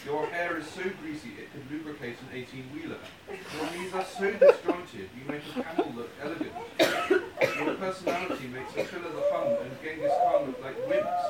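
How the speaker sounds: losing it completely mid-read; cracking up. Real, not acted. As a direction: read the insults while collapsing into laughter. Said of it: "keep a straight face".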